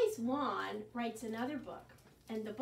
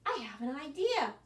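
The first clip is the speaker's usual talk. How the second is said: ordinary talk, nothing marked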